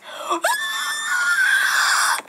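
very high, shrieking cartoon laugh; high shrieking giggle; squealing laugh. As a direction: squeal with glee. Toy reacts to blue fire.

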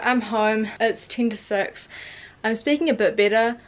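normal talking voice

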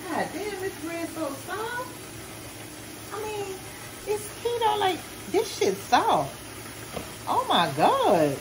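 excited high squeal; amazed. Real, not acted. Amazed by soft bread.

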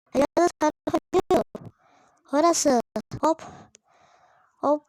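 ordinary talk, nothing marked

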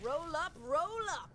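carnival barker. Acted.

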